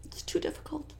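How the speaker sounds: high, fast, then fading to a whisper; mock distressed Lori. Lori dodging the trainer with mock distress, high then whispered. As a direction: play up fake distress. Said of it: "And she says".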